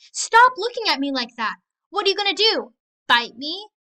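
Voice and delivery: loud, high, rattled shouting; she's unnerved and backing off; nervous outburst. Acted. Bully panics at smile.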